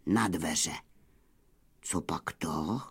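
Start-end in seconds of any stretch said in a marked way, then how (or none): 1.8-2.8 near-whisper, low and soft; hushed wary question. A narrator's reading. Wolves wondering who is at the door, hushed whisper.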